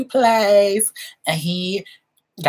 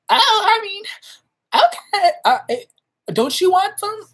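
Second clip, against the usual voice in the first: flustered. Retold or imitated. Flustered retelling of snack.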